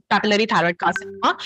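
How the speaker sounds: normal talking voice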